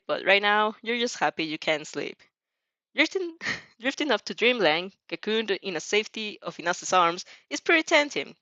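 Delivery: ordinary talk, nothing marked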